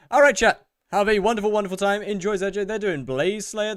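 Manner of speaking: high, loud farewell to chat; big announced goodbye; calling out a sign-off. Real, not acted. Signing off, sending viewers to another stream.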